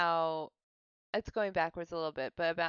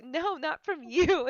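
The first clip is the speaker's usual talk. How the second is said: louder, slower, pointed refusal; mock snappy refusal; 7 semitones higher, 8 dB louder, slower than usual. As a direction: turn him down loudly and slowly. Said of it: "And she's like".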